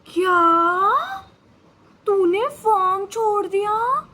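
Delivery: loud, stunned friend's outburst; shocked disbelief. Retold or imitated. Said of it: "पता है उसका reaction क्या था?".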